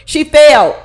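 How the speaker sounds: failed punched out loud; emphatic loud. Shown as a demonstration. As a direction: hit "failed" hard and loud. Repeated louder.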